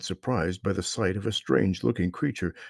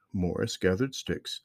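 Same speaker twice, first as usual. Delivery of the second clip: ordinary delivery, nothing marked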